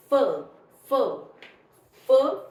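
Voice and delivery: "f" sounded as a short "fuh", spaced by pauses; isolated F sound. Shown as a demonstration. Teacher demonstrates PH, "f" repeated as a separate loud sound. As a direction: punch each "f" separately. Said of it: "पीएच is pronounced as".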